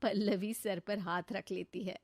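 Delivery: plain reading voice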